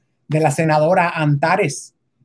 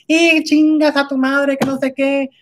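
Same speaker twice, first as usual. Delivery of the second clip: much higher, louder, slower mimicry of a rude woman; a rude stranger cursing back, mimicked high and loud; mocking angry retort; 9 semitones higher, 3 dB louder than usual. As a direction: throw back her insult in a shrill mock voice.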